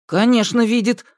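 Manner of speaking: mildly angry, high-pitched retort; irritated character voice; cross. A narrator's reading. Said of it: "рассердился Сенька".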